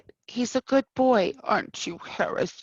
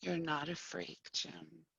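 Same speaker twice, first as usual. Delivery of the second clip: gentle reassurance; 3 semitones lower, 9 dB quieter than usual. Acted. Doctor comforting patient softly.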